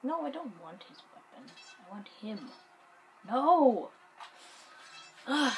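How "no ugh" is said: loud, higher cry of no; loud protest. Real, not acted. Gamer not getting the pickup wanted, loud protest. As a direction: shout it out in protest. Calling out at game mishap.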